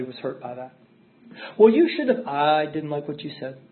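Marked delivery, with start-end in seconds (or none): 1.6-2.3 higher and louder snappy comeback; coworker's sharp retort. Retold or imitated. Coworker snapping back at her complaint, higher and louder. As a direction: throw the line back hard and high.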